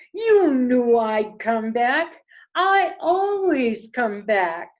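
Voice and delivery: big projected mother owl voice; mother owl reassuring. Acted. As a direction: declare it firmly as mother owl.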